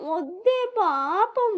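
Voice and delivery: high, slow, drawn-out plea; pitying. A narrator's reading. Sneha begging her sister to spare him, pitying. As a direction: plead for him with pity. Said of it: "జాలిగా చెప్తుంది".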